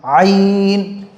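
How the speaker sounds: "आइन" from the throat, with a long held vowel; pronunciation demo. Shown as a demonstration. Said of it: "मुश्किल लफ्ज़ है". ("आइन" हलक से खींचकर)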